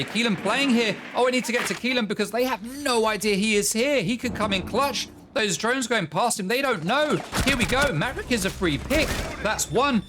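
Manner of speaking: thrilled play-by-play as the clutch builds; excited call. Real, not acted. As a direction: get swept up in the clutch. Clutch moment in the match.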